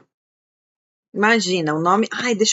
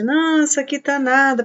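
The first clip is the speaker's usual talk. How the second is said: surprised exclaim; 7 semitones higher than usual. Real, not acted. Reacting to viewers' heat comment.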